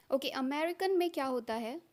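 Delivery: ordinary talk, nothing marked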